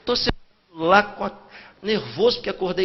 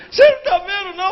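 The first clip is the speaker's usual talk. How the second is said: high, loud tearful shout; crying fan shouting; 9 semitones higher, 7 dB louder, faster than usual. Retold or imitated. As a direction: yell it through tears. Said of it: "chorando e gritando". (fã gritando e chorando)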